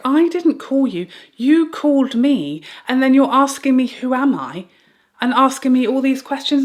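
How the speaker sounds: loud, high, frantic protest at the caller; exasperated yelling. Retold or imitated. Mother to the confusing caller, loud frantic protest. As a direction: half-shout it, fed up and rattled.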